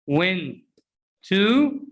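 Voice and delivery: "Went to" split by a pause; word-by-word "Went to"; unreduced citation form. Shown as a demonstration. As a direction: isolate "Went", then a full "to". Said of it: "to is pronounced".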